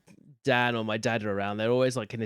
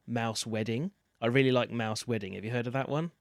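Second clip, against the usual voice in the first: ordinary delivery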